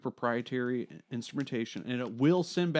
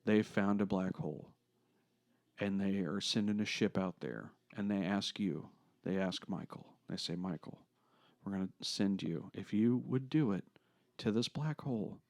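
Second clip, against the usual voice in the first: hushed, dramatic question; 5 semitones lower, 9 dB quieter than usual. Shown as a demonstration. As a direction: lower and softer, building suspense. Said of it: "I wanna ask a question".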